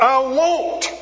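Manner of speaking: a club man shouting his refusal at the preacher; defiant shout. Retold or imitated. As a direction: fling it back at the preacher. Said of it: "shouted out".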